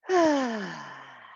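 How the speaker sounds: long audible exhale through an open mouth; open-mouth sigh. Shown as a demonstration. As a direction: sigh it all out, falling away. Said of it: "Sigh it out".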